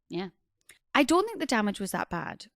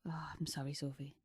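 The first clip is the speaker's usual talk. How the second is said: whispered apology; 5 semitones lower, 16 dB quieter than usual. Real, not acted.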